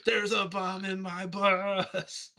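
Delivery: slow, high action-hero line; drawn out like a movie hero; Keanu impression. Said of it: "I wanted to do an East joke".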